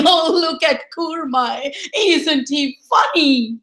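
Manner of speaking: high, lightly mocking crowd voice; amused onlookers. Crowd gossiping to each other about Kurmai, amused. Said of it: "they were smiling and laughing and pointing".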